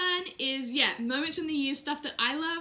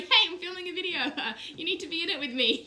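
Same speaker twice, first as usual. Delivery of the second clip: laughing; 5 semitones higher, 3 dB louder, faster than usual. Retold or imitated. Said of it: "I was like".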